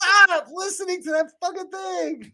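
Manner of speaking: very high, loud comic scolding; exasperated mock yell. Player telling paladin to ignore talking item, exasperated yell. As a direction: yell in playful despair.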